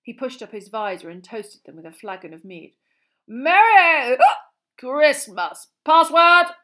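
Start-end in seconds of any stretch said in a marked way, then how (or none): 3.3-6.5 boisterous knight. A narrator's reading. Sir Cadogan hails them.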